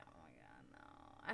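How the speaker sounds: very low, soft groaning dismay. Retold or imitated. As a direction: drop way down into a dreading groan. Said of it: "and I'm like".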